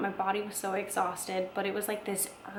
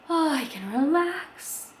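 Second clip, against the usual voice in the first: higher, slow, relieved; relieved sigh; 7 semitones higher, 4 dB louder than usual. Retold or imitated. Mother feels the morphine kick in, slow relieved sigh. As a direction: melt into relief.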